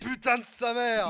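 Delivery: French curse. Pretend. Guest joining the French swearing for the host, slow loud curse. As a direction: spit the French curse out slowly. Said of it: "We can say all those things".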